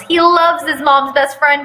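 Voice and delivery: ordinary delivery, nothing marked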